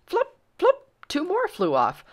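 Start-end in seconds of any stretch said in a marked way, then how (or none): 0.1-0.9 squeaky high flop, flop; high flop sound. A narrator's reading. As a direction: pop it out high and bright. Hats flying off, sound effect.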